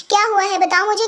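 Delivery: ordinary talk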